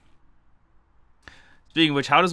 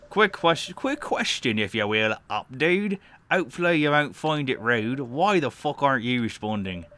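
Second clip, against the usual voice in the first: badly faked British lady, pitched up; shitty British accent; 3 semitones higher than usual. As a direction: put on a hammed-up, shoddy British accent. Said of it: "I'll give the female a shitty British voice".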